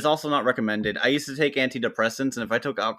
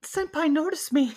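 high, breathy anime fangirl plea; overacted anime dub. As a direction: beg senpai to notice you, anime style. Anime senpai bit.

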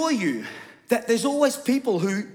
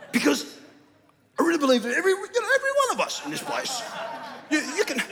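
mock another preacher's voice, pitched up with hesitant repeats; Brian Houston; 5 semitones higher, faster than usual. Pastor showing the congregation the requested preaching voice, high mock impression. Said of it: "more like Brian Houston, have that sort of voice".

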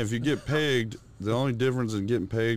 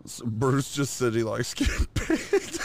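laughing; slower than usual. Real, not acted. Teasing friend, cracking up.

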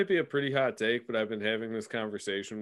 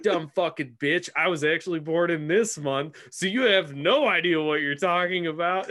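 mock jerk guy; 6 semitones higher, 6 dB louder than usual. Guy mocking girl in retold story.

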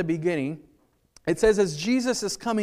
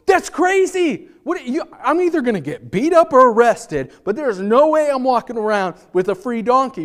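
much higher and louder, slowed open; exasperated protest; 5 semitones higher, 9 dB louder than usual. Retold or imitated. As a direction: protest loudly to Jesus in disbelief. Imagined disciples protest to Jesus.

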